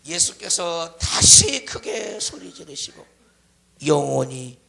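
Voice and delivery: slow, stretched verse sinking low; solemn scripture recitation. Real, not acted. Preacher reciting Jesus's death to congregation, solemn scripture recitation. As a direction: intone the verse, dropping deep at the end.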